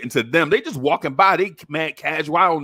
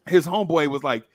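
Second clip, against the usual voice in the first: ordinary talk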